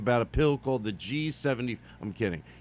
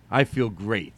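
ordinary delivery

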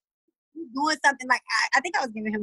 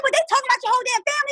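much higher, fast and fired up; quick indignant comeback, much higher; heated retort; much faster than usual. Retold or imitated. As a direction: fire back at your daughter fast. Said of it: "I said".

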